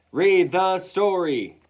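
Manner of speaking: loud, high, drawn-out demand; class shouting. A narrator's reading. Class demands the story, slow loud shout. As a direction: shout it slowly, like a whole class insisting. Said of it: "the rest of us shouted".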